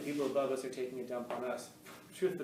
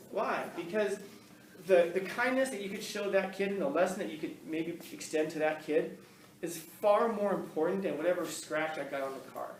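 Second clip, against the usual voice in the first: ordinary talk, nothing marked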